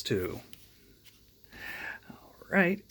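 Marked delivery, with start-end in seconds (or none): 2.1-2.8 whisper. Real, not acted. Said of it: "whispered lead-in".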